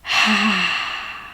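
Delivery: long, breathy "haaa" sigh; soft audible exhale. Shown as a demonstration. Coach showing how to exhale through the mouth, soft audible sigh. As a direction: let the breath out slowly and audibly. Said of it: "You can make a soft, audible".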